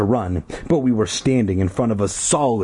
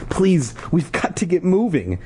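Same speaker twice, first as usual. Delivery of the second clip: pleading; 8 semitones higher than usual. A narrator's reading. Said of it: "she pleaded".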